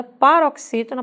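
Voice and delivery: usual speaking voice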